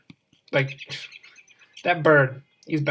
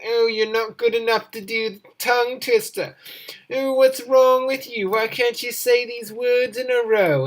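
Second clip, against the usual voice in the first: mock taunting; 6 semitones higher than usual. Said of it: "horrible emails saying that".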